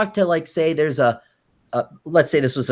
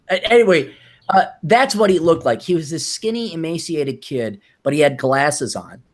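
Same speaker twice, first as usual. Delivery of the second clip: ordinary talk, nothing marked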